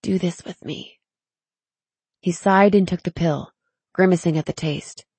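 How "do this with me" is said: hushed, softer pleading; soft plea. A narrator's reading. Tally begging Zane to take the pill, pleading softly. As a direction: plead gently, keeping it soft. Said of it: "she pleaded softly".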